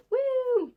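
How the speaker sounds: high-pitched little whoop; excited woo. Real, not acted. Excited about a horror book, high whoop. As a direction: let out a quick high "woo".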